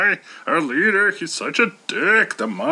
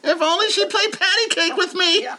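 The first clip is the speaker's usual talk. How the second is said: high cartoon rabbit voice; squeaky cartoon rabbit; Robert the Rabbit; 12 semitones higher than usual. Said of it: "Robert the Rabbit".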